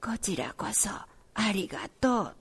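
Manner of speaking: slow, very soft whisper; small voice. A narrator's reading. Ailing grandfather answering grandchild's thanks in a faint whisper. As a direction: whisper it faintly, drawing the words out. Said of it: "小さい声でも言ってくれました".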